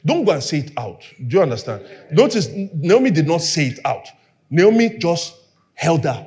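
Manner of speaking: usual speaking voice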